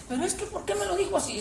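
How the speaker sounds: whiny complaint. Pretend. Congregant complaining about blunt pastor.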